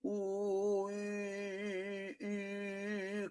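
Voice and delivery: rounded fish-lip vowels, high and soft; lips pursed. Shown as a demonstration. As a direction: sing "Ooh, E, E" with rounded lips. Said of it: "where you round your lips".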